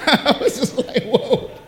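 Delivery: chuckling through the words; laughing. Real, not acted. Preacher laughing at the pastor's threat, chuckling.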